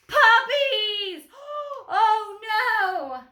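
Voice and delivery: very high, loud shriek with a gasp; terrified scream. A narrator's reading. Mice spot the puppies at the barbecue, horrified shriek.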